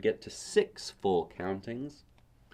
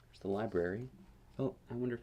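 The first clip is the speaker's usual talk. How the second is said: plain reading voice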